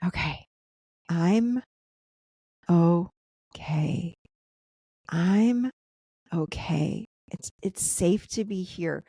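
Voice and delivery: slow self-soothing, long pauses between words; nice and slow. Shown as a demonstration. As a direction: say it slowly to reassure yourself, word by word. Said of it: "you gotta say it nice and slow".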